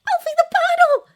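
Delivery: high, shrieked warning; startled, squealed alert; alarmed gasp. A narrator's reading. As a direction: cry out urgently, very high and loud. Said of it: "gasped Glinda".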